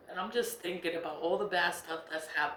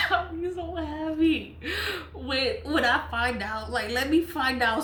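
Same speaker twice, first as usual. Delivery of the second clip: laughing; 6 semitones higher than usual. Real, not acted. Gleeful over others' bad luck.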